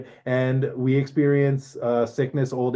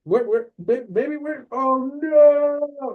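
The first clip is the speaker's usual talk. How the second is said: very high, stammering frantic search; mock panic; 13 semitones higher, 4 dB louder, slower than usual. Orpheus looks back for his lover, high mock panic. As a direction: break into a hammed-up high wail of dismay.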